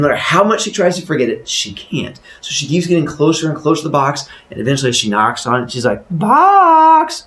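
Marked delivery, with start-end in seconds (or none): 6.1-7.3 loud, high drawn call. Acted. Woman knocking on the box, high loud call. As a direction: hail it in a high put-on voice.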